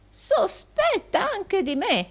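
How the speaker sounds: very high, slow wail; theatrical wounded cry; exclaiming old usurer. A narrator's reading. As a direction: drag it out in high-pitched disbelief. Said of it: "esclamò il vecchio Arturo".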